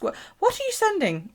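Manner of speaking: a hint of a nosy counter clerk; mock post office clerk. Viewer imagining the post office clerk asking, lightly mocking. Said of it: "The post office usually ask".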